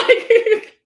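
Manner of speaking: loud high burst of laughter; laughing. Real, not acted. Laughs loudly.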